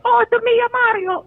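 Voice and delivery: very high, mock Italian cartoon voice; hammed-up falsetto caricature; Mario. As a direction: squeal it in an exaggerated Italian accent. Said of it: "that's a racist voice".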